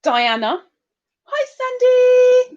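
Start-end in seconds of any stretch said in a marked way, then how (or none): 1.2-2.5 tiny high-pitched drawn-out greeting; high, stretched, small-person hello; little high voice. Acted. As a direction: squeak out a slow little hello. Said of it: "seemed like such a quiet little".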